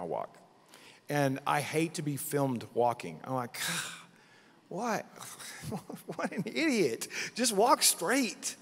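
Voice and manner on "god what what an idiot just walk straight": high-pitched scolding of oneself; self-mocking exasperation. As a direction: berate yourself in a high, incredulous voice. Mocks own walk seen on film.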